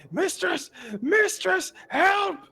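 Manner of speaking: high, loud panicked shrieks; hammed-up screeching terror; screaming for help. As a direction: scream for your mistress as you flee. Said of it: "seemingly screaming".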